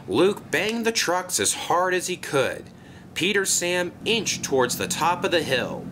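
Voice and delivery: plain reading voice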